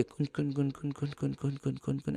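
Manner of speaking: a low, barely heard eerie hum repeated; eerie murmured kun chant. Retold or imitated. Narrator imitating the strange sound the bride makes, very soft. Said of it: "ऐसी कुछ आवाज़ आ रही है".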